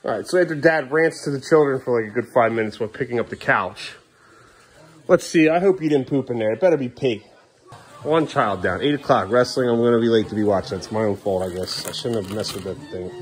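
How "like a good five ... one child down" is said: ordinary delivery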